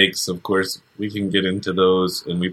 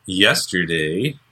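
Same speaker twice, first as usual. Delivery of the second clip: ordinary delivery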